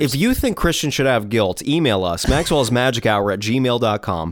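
normal talking voice

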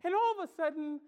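normal talking voice

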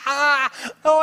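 high, loud mock guffaw; crazy laughing. Angel laughing at old pastor, uproarious. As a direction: howl with helpless put-on laughter. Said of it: "The angel was just laughing like crazy".

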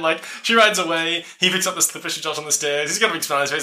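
normal talking voice

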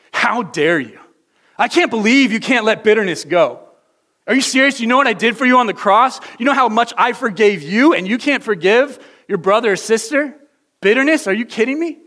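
loud, high, outraged scolding; harsh angry God. Acted. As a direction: berate them in furious disbelief. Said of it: "Does it sound like this?".

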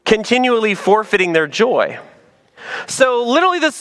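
usual speaking voice

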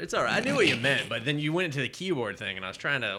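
ordinary delivery

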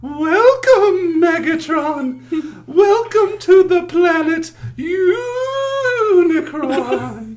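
high, slow, drawn-out ghost voice. Acted. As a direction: stretch every word, eerie and high. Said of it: "you're good at the ghostly voice".

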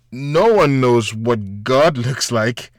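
slow, firm teacher voice; stern teacher. A narrator's reading. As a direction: lay down the law slowly. Teacher's stern retort in joke.

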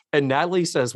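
ordinary talk, nothing marked

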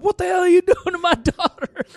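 high, loud outraged dad; angry dad. Retold or imitated. Dad on the phone catching a man upsetting his daughter, loud and high. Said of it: "the dad picks up".

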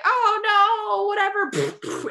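high, slow mock shrug; mock unbothered villain; bored dismissal. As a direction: wave it off like nothing.

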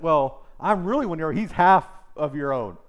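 loud, high outbidding claim; mock rival boast. Rival candidate appealing to the crowd, loud mock boast. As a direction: outdo the other guy, loudly.

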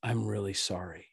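low, soft, sincere apology; retold apology. Dad apologizing to the weeping son he holds, low and soft. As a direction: apologize gently, voice dropped and quiet. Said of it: "he just starts apologizing".

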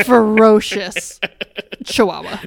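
slow, loud ferocious; comic slow emphasis on ferocious; drawn-out joke. Real, not acted. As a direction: stretch ferocious out for comic effect. Stretched joke label.

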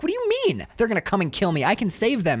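high-pitched disbelief; acted incredulous outburst. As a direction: protest as if it can't be true. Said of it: "he's incredulous".

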